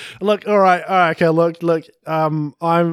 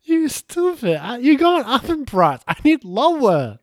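high, put-on broken-English bargainer; haggler's voice; 8 semitones higher, slower than usual. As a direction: haggle hard in a high pidgin voice.

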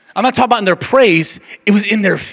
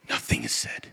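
whispered; 17 dB quieter than usual. Acted. Hushed point in sermon.

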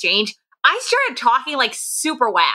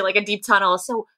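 ordinary talk, nothing marked

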